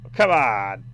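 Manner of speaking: frustrated outburst. Real, not acted. Frustrated at stubborn game.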